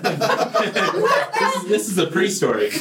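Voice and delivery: amused laugh mid-sentence. Real, not acted. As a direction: chuckle at your own detour. Laughs at own digression.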